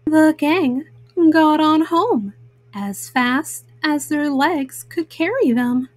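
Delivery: ordinary delivery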